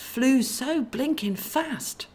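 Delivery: high, slow exclaiming angel; character voice. Acted. Angel wonders aloud at the speeding figures. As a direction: marvel at how fast they flew.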